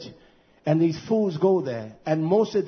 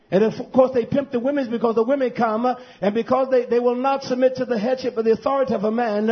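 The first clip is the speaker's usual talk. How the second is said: raised, insistent sermon pitch; impassioned preaching; 8 semitones higher, 4 dB louder than usual. Real, not acted. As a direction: press the accusation in a high preaching voice.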